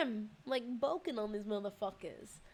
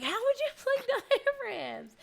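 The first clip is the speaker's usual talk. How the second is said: much higher, louder and slow, laughing through it; laughing insistent question; 13 semitones higher, 7 dB louder than usual. Retold or imitated. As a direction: giggle while demanding an answer. Insisting to woken partner.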